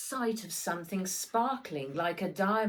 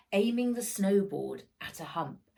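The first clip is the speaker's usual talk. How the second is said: plain reading voice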